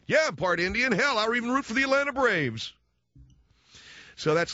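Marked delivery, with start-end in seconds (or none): 0.1-2.7 high, excited proclamation; hammed-up enthusiasm; eager mock boast. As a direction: claim it proudly, pitched way up. Acts out eager would-be Indian.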